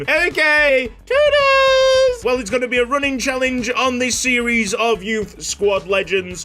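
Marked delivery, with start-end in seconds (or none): 0.0-2.2 falsetto, loud posh toodles; posh farewell. Acted. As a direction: fling it out in a high posh falsetto. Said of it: "Posh Cutsy".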